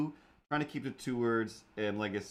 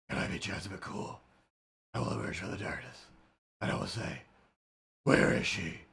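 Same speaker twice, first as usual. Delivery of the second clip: spooky whisper; 2 semitones lower than usual. Pretend. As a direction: creep out of the dark, hushed. Dramatic persona emerging from darkness.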